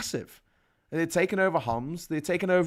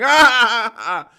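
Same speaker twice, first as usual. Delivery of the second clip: loud, high "ah" trailing down; mock wailing over a magic orb; hammed-up open-mouthed "aah"; imitated group chant; 6 semitones higher, 11 dB louder than usual. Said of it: "with them going".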